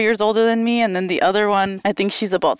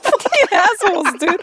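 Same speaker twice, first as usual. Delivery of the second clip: mock annoyed curse; 10 semitones higher than usual. Cursing sisters.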